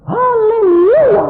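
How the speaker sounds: imitated high, drawn-out crying scream; crazed praise shout. Preacher imitating a woman's wild praise, crazed shout. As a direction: scream it ecstatically, like someone gone mad. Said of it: "Jadi kayak orang gila".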